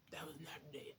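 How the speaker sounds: breathy whispered denial; whispered that was not a date. Retold or imitated. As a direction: whisper it as if insisting to yourself. Denying it to mom.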